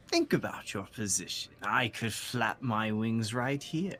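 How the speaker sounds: menacing bird creature. Acted. Bird threatens humans on the cliff, menacing creature voice. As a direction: draw out the scorn, then menace slowly.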